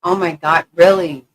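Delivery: loud shocked disbelief; surprised. Real, not acted. Reading a comment about flooding.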